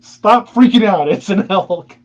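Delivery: loud, high, drawn-out scolding; exasperated telling-off. Retold or imitated. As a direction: scold your panicking buddies, stretching it out. Retold exasperated telling-off of friends.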